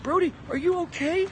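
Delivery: mock the worried guys in a squeaky voice; mocking concerned voice. Comic mimicking people fussing over his good mood, squeaky mock concern. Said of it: "The Cubs didn't go to me".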